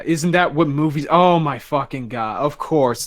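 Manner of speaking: ordinary delivery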